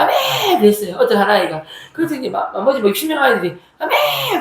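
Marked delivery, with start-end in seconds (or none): 0.0-0.6 a high, ringing amen; high amen cry. Retold or imitated. Child shouting amen. 3.8-4.4 a high, drawn-out amen; high amen call; chorus amen. Retold or imitated. Sixty children answering amen.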